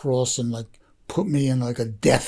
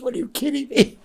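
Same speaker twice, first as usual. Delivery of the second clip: much higher, amazed disbelief; incredulous; 12 semitones higher than usual. Retold or imitated. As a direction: toss it back like the question is absurd. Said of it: "He goes".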